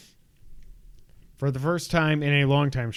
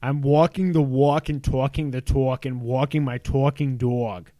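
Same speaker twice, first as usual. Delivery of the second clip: Long Island accent with drawn "aw" vowels; exaggerated New York accent; 3 dB louder than usual. Shown as a demonstration. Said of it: "say it in my accent, which is exaggerated".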